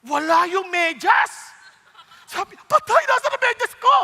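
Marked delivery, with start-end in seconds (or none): 2.7-3.9 higher, louder panicked search; panicked cry. Acted. Husband panicking in the night over his lost sock. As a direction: yelp in alarm at the missing sock.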